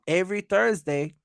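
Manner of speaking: usual speaking voice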